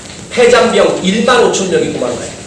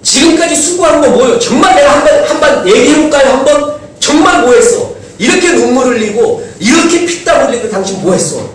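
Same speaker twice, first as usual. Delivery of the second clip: high, loud accusing outburst; a war hero snapping at latecomers; angry retort; 8 semitones higher, 4 dB louder than usual. Retold or imitated. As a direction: throw the question back at them, furious. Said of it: "이 말 한마디 하면 전쟁이 일어날 판".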